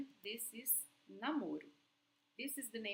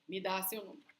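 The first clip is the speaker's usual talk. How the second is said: curt, demanding "Me dá seu número" with a hard stress on "dá"; rude demand; 2 semitones lower, 5 dB louder, much faster than usual. Shown as a demonstration. Teacher showing students the wrong rude way, curt demand. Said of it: "with an intonation to not sound rude. It's not like".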